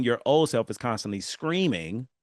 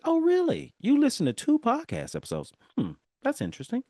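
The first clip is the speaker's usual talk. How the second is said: future self voice; 12 semitones higher, 3 dB louder than usual. Retold or imitated. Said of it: "my future self was like".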